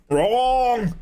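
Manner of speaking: high, drawn-out Arnold-style cry; Arnold impression. Streamer stuck in an Arnold loop, Arnold impression. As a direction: blurt one long word as Arnold. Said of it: "[name]'s entered his Arnold loop".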